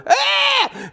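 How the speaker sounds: high-pitched enraged yelp from the crowd; crowd's outraged high cry; mock angry cry. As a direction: shriek like a furious mob. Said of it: "They went".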